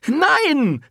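very high, loud cry; called out no. Acted. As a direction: cry it out defiantly. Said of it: "rief Rosetta". (laut gerufen)

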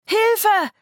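high, loud scream for help; terrified scream. Acted. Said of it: "schrie sie".

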